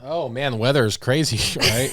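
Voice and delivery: ordinary delivery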